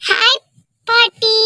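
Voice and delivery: put-on high, bright child voice calling hi; a small kid greeting granny; child's greeting.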